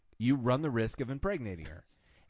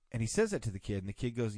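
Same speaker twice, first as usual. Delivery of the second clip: ordinary talk, nothing marked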